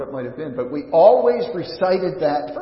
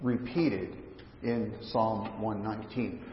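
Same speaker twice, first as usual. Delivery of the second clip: slow quoted question; 6 semitones lower, 3 dB quieter than usual. Retold or imitated. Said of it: "it was this".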